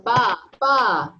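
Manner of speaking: loud storybook bleat; playful, punched sheep call; sheep's bleat. A narrator's reading. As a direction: bleat like a sheep for little kids. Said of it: "bleated the sheep".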